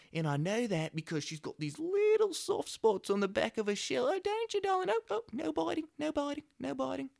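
high-pitched, gushing Australian impression; Steve Irwin. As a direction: coo over a baby animal in dorky, high Australian. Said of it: "so Australian and just adorable and dorky".

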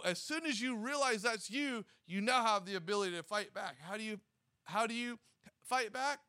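usual speaking voice

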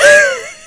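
a sudden high laughing yelp; burst of laughter. Real, not acted. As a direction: laugh out loud at the joke. Laughs at her own example.

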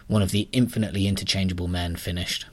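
ordinary delivery

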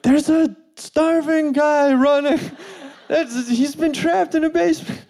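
exclaiming onlooker. Acted. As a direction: call out in high-pitched amazement, half laughing. Imagined spectators exclaiming at runner.

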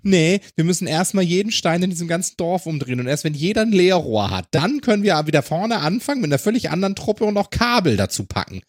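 high, exasperated mock explanation; mocking the workers. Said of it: "Also musst du jetzt sagen".

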